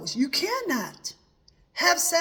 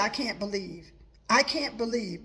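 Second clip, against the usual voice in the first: ordinary delivery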